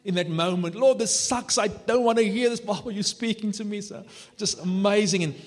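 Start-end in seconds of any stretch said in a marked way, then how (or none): 0.8-3.8 high, loud complaint, easing off at the end; acted protest to God. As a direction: protest to God in mock exasperation. Acted protest to God in retold moment.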